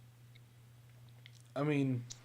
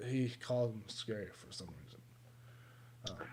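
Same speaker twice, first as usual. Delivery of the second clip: whispering; 4 semitones lower, 8 dB quieter, slower than usual. Real, not acted. Aside whispered low.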